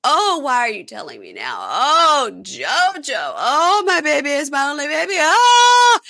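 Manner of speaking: high, loud hammed-up wail; mock wailing. Woman grieving leaving her dog behind, mock wailing. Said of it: "So she's bawling and crying and wailing".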